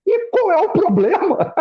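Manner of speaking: high, slow, half-comic what's-the-problem; a father's playful so-what, high and slow; mock puzzled. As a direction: shrug it off in a high, drawn-out voice. Father answers son's confession casually.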